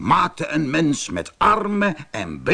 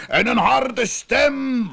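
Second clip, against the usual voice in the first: roaring bear; 10 semitones higher, slower than usual. A narrator's reading. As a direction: draw it out high as a storybook roar. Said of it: "brulde de beer".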